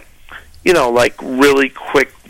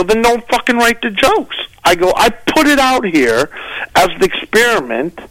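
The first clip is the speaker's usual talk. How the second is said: raised, heated comeback; [name] firing back at the comedian, higher and heated; retold exasperated reply; 7 semitones higher than usual. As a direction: push back hard at her. His own retold angry reply.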